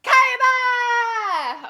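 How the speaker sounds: high, loud drawn-out scream; full-throated anime attack shout; battle cry yell. Acted. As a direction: swing a sword with all your might. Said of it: "big battle cry yell".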